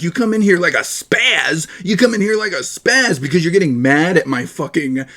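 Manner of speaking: usual speaking voice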